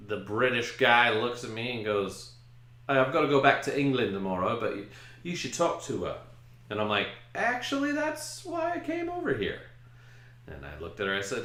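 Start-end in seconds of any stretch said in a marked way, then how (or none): 2.9-6.2 put-on British accent. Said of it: "he's British". 7.3-9.6 much higher, eager little voice; eager squeak. Retold or imitated. Guest eagerly telling the British groom's brother why he came, in a high squeak.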